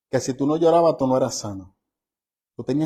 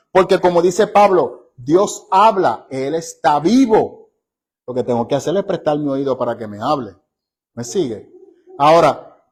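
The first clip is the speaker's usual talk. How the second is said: emphatic preaching; 3 dB louder than usual. Acted.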